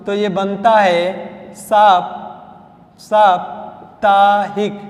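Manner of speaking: साप्ताहिक drawn out very slowly, long first saa; slow dictation. Shown as a demonstration. Teacher showing how the suffix lengthens the vowel, slowly. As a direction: stretch साप्ताहिक out for writing. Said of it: "सप्ताह में इक प्रत्यय लगा देंगे".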